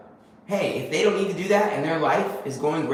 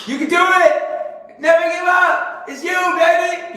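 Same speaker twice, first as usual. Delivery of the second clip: much higher and louder, slow, cheering shout; loud inner voice; 14 semitones higher, 8 dB louder, slower than usual. Retold or imitated. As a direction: yell it like your own loudest cheerleader. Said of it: "loud ass voice in my head that's just saying".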